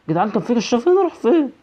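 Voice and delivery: high, loud frantic repeated no; panicked screaming. Real, not acted. Gamer panicking as the monster chases, screaming no. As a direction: shriek no over and over in panic.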